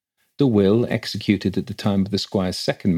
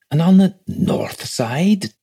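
high, slow incredulous question; shocked; 10 semitones higher, 3 dB louder, slower than usual. A narrator's reading. Clerk querying a north side grave, shocked. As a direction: echo it slowly, pitch soaring on disbelief. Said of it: "said Wickham in a shocked voice".

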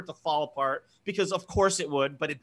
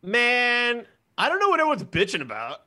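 normal talking voice

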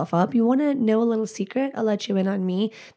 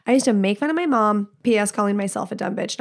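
ordinary talk

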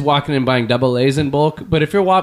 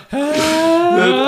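high laughing cry; 11 semitones higher than usual. Retold or imitated. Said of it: "Adam was like".

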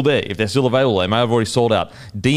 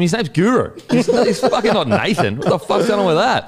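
higher, laughing incredulous retort; laughing disbelief; 10 semitones higher than usual. Retold or imitated. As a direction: laugh while you protest. Retold reply, laughing, incredulous.

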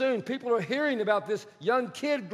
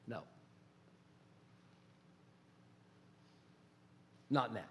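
God's quiet answer; 12 semitones lower, 20 dB quieter than usual. Acted.

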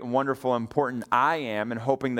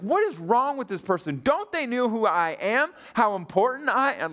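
higher pitched, indignant mock rant; hammed-up self-important grumbling; frustrated rant; 8 semitones higher than usual. Pastor replaying his impatient thoughts at the light, indignant. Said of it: "I was just so frustrated".

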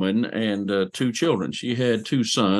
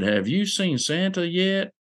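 put-on higher, slow question for a child; gentle question to kids; 8 semitones higher, slower than usual. Asking kids about Santa.

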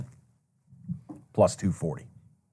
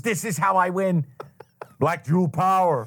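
higher, hyped gambler line; a hint of a frantic gambler; Adam Sandler quote; 7 semitones higher than usual. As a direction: quote the movie gambler in a mock voice. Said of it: "Adam Sandler Uncut Gems".

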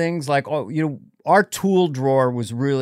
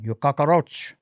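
mock Cuban accent; Al Pacino; slower than usual. Podcast guest quoting a film to the host, mock Cuban accent. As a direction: imitate a movie star's Cuban accent. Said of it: "Al Pacino doing a Cuban accent".

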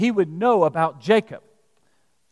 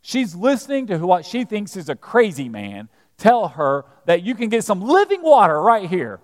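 ordinary talk, nothing marked

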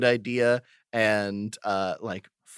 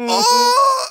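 excited shout; 7 dB louder than usual. Real, not acted.

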